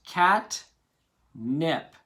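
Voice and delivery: "cat" and "nip" said apart as two parts; separating the syllables; syllables split. Shown as a demonstration. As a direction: scoop each part, "cat" then "nip". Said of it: "Then we scoop our parts".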